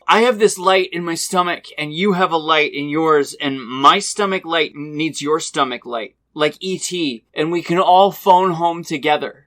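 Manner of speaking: earnest, high pleading voice; lightly voiced earnest teammate; quoted hockey player. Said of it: "through the wall he goes".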